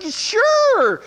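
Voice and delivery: high, drawn-out yes; mock eager agreement. Asked to come work Thursday, mock eager. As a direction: agree too keenly, putting it on. Retold forced agreement on phone.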